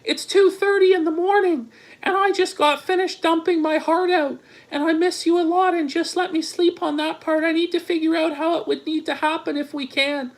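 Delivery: high-pitched put-on woman's voice; put-on falsetto girlfriend; mock girlfriend voice. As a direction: read her text in a mocking high register. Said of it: "She goes".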